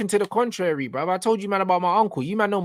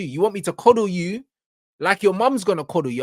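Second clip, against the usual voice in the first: ordinary talk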